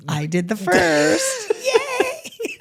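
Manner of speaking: teary laugh, high cheer; laughing through tears. Real, not acted. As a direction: cheer while half crying.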